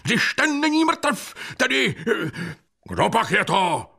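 very high, worked-up demand; high, flustered voice; agitated question. A narrator's reading. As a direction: call out bewildered, pitch way up. Agitated bystander demands who it is.

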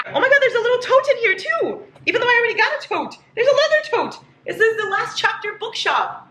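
high, fast thrilled chatter; unboxing a tote, high and giddy; excited high gush. Real, not acted. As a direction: rattle it off giddy with delight.

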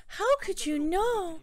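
high, drawn-out disbelief; acted shock. Yuna hearing her secret revealed, high slow shock. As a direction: play stunned, stretching it out high.

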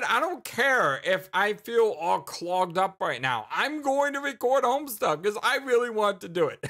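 hammed-up, emphatic self-quote; mock defiance. Speaker acts out own stubborn resolve.

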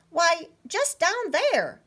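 high, slow, playful squirrel; lightly voiced cheeky squirrel; giggly squirrel. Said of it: "giggled the squirrel".

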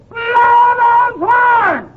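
high holler, hugely stretched out; bigger comic shouting. Acted. Frightened patient demanding noise around him, long drawn-out shouting. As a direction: drag every word into a long bellow. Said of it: "there's no sense in shouting".

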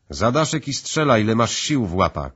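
loud, high urgent command; shouted order. A narrator's reading. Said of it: "krzyknął Crichton".

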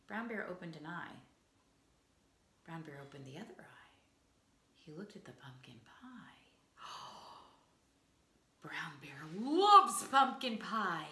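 hushed storyteller whisper with a gasp; suspenseful whisper. A narrator's reading. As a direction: draw the children in, barely breathing. Suspense as bear wakes.

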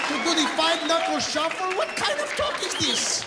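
very high, strained indignant cry; mock outrage. Comedian appalled at his own list of crude phrases, mock outrage. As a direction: climb to a scandalized peak.

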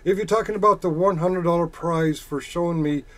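ordinary talk

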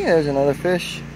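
ordinary talk

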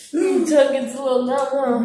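teasing through giggles; laughing. Real, not acted. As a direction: laugh while you tease. Teasing sister after spicy bite.

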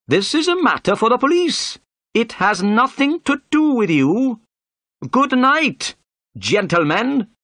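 high-pitched, indignant inspector; irate official shutting the conversation down; angry. A narrator's reading. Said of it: "turned to Holmes angrily".

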